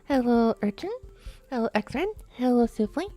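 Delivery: usual speaking voice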